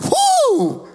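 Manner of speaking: high startled huh; surprised gasp. Retold or imitated. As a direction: gasp in shock. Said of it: "Haben die Leute so".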